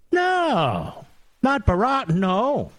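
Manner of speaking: high, drawn-out mock-scandalized no; mock horrified denial. Host mock-defends Obama from toilet jokes, high mock horror. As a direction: feign shock at the very idea.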